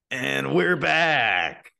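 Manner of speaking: slow, drawn-out announcer sign-back; radio announcer return. Pretend. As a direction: stretch it like a radio host coming back. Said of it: "I've always wanted to say this".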